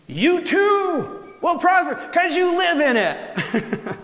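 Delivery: emphatic. Acted. Emphatic reading, laughing.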